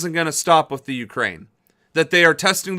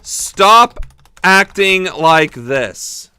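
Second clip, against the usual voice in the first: pleading shout; 6 dB louder, much slower than usual. Acted. Vlogger yelling a plea at Russia, loud and slow. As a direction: cry out at Russia, slowly.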